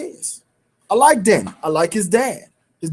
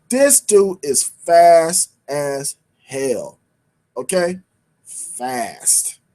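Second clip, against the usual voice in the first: slow, word-by-word emphasis; emphatic; 7 semitones lower, much slower than usual. Real, not acted. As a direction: land each word separately, drawn out. Stressing how fast he is.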